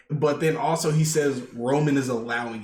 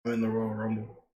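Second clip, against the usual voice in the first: low, softer murmur of a retold line; 4 semitones lower, 8 dB quieter than usual. Jay telling Roman he's entering the Rumble, murmured. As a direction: mutter it under the breath, defiant. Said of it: "he murmurs".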